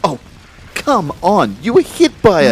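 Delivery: high, loud, incredulous protest; exasperated outburst. Acted. Kyle angrily dismissing Laura's claim they aren't dead, loud and high. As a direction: cut her off in disbelief.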